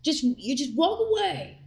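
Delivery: high mocking just walk away; imitating him. Wife mimicking husband telling her to walk off, high mock voice. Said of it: "he's like".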